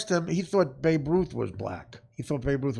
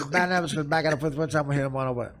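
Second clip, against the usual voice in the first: mild mock garbled interview answer; Mickey Rivers impression. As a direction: slur it like Mickey Rivers, barely coherent. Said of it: "exactly what happened".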